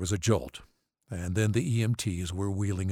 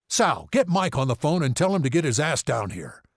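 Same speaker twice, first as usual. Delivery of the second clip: loud, higher-pitched impatient order; angry, bossy father; 6 semitones higher, 5 dB louder than usual. A narrator's reading. Father ordering his wife to phone the doctor, angry.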